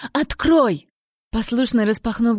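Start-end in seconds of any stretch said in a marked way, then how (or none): 0.1-0.9 shout. A narrator's reading. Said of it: "закричала Амбер".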